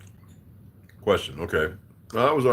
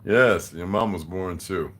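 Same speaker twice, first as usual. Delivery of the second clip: ordinary talk, nothing marked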